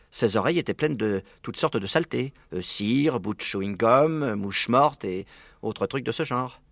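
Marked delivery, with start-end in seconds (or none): none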